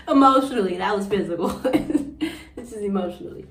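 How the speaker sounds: laughing through the words. Real, not acted. Woman laughing at her own mistake, laughing correction. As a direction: catch the slip and laugh it off.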